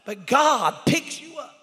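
climbing to a high sustained shout; full-force preaching. Real, not acted. Preacher telling congregation they are members, building to shouting. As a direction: drive each phrase home at full volume.

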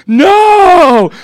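long, high, loud wail; hammed-up scream of protest; mock anguished yell. Team told to leave, yelling in protest. Team's retold protest at leaving.

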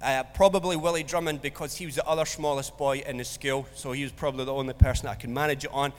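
ordinary talk, nothing marked

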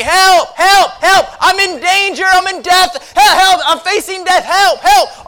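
high, very loud mock distress cries; hammed-up desperate shouting; acted screaming for help. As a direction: yell as if hailing a distant rescuer. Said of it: "You'd be screaming".

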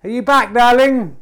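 loud, high call to another room. Real, not acted. Host calls out to his wife arriving home, loud high call.

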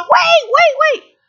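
high, slow insistent wait; urgent protest. Retold or imitated. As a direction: stop them in alarm. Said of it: "and I said".

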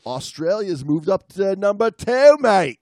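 light put-on Australian twang; mock Australian. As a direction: play the jolly Australian bloke.

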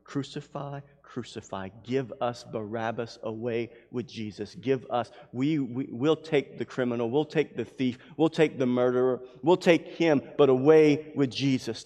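usual speaking voice

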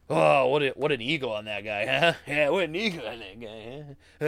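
imitating a snarky critic; mock outrage. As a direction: sneer at his ego in mock disgust. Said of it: "comes on and says".

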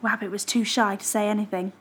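ordinary delivery

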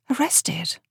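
breathless horrified whisper; shocked whisper. Acted. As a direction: let the word escape in a hushed gasp. Phoebe horrified by soldier's news.

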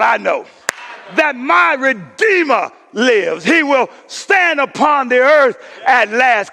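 slow, loud declaration; shouted proclamation. Acted. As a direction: shout it out triumphantly.

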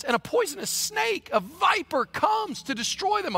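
high, loud, shouting sermon climax; preaching at full force. Acted. Preacher shouting to his church about crushing the viper.

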